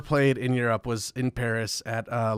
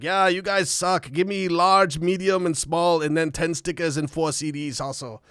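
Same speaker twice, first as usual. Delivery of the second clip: German fan; 3 semitones higher than usual. Retold or imitated. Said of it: "the guy's like".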